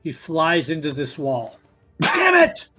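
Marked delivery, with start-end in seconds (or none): 2.0-2.7 frustrated outburst. Acted. DM voices frustrated NPC hitting wall.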